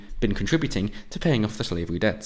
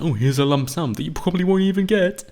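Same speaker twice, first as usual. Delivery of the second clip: higher sneering put-on voice; mock official; 8 semitones higher, 4 dB louder than usual. Mocking the state offering pensions, higher put-on sneer. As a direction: lampoon a bureaucrat handing out cash.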